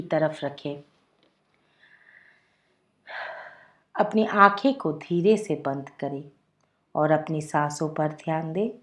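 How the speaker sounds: soft, unhurried meditation voice with long pauses; guided meditation. Acted. Leads a guided relaxation meditation. (धीमी, शांत ध्यान निर्देश की आवाज़)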